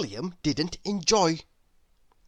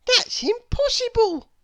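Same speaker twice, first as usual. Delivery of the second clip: put-on much higher boy's astonished cry; astonished little schoolboy; amazed William cry; 5 dB louder than usual. A narrator's reading. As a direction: cry it out like a wide-eyed boy. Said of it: "cried William".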